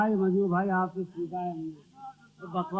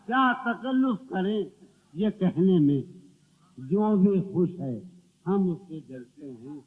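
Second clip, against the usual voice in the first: ghazal recitation; 6 dB louder than usual. Acted. Poet reciting to the gathering, declamatory verse recital. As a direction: declaim verse with weighted pauses.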